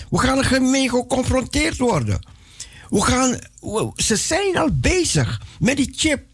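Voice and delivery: ordinary talk, nothing marked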